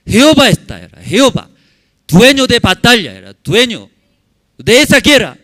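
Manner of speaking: loud, high preaching; preaching at full force. Acted. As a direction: thunder it out to the congregation. (predicación a todo pulmón)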